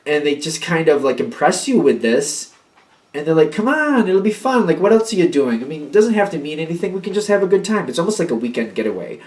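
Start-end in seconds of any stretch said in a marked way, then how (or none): 3.5-7.9 higher, light mock coaxing of a partner; coaxing invite. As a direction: lightly voice an eager partner tempting them. Coaxing partner voiced in reading.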